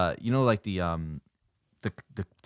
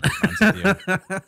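laughing; 11 semitones higher, 9 dB louder than usual. Real, not acted. Mock threat to friends.

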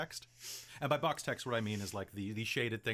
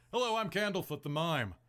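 radio announcer voice; 4 semitones higher, 4 dB louder than usual. Shown as a demonstration. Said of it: "Candlefoot's voice is deep and sultry, and he sounds like a radio announcer".

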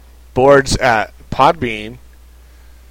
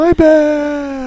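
mock Balrog; 14 semitones higher than usual. Echoing the Balrog line.

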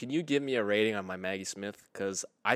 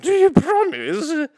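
high, loud, slow put-on voice; put-on old lady; 9 dB louder, much slower than usual. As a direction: quaver like a grand old dame. Teasing Maggie Smith voice.

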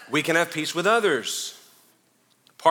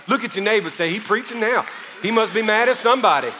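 loud, high call to the congregation; preaching call-out; 8 semitones higher, 5 dB louder than usual. Acted. Pastor rousing the church, loud and high. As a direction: get the room to echo you. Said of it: "Am I preaching good up here".

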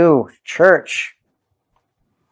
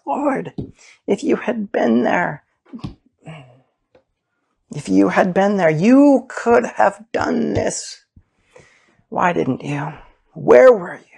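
grieving lament; 6 semitones higher than usual. Acted. Voicing Mary's heartbroken lament.

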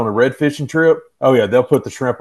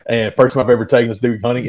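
ordinary talk, nothing marked

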